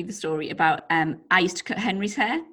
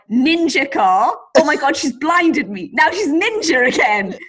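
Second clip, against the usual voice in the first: mock driver voice; 7 semitones higher, 5 dB louder, slower than usual. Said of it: "the cars that were approaching must have been like".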